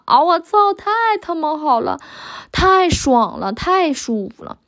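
high, drawn-out retold exclamations; imitated crying out. Speaker imitating a partner's delighted reaction, mock crying out. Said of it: "嗷嗷叫唤".

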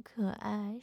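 ordinary talk, nothing marked

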